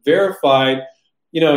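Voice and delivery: ordinary delivery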